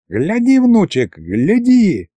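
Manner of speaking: high, urgent grandfather; thrilled old man pointing it out; excited urging. A narrator's reading. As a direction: make him look right now. Grandfather urges grandson to look.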